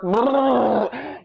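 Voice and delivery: drawn-out shuddering shock noise; mock electrocuted "brrr"; imitated torture noise. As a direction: act out a jolt of electricity. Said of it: "You're making this noise".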